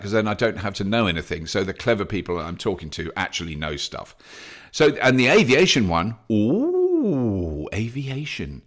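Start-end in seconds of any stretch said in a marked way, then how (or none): 6.3-8.6 excited ooh. Real, not acted. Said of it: "I get very excited about".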